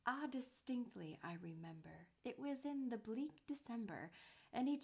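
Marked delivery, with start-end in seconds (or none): none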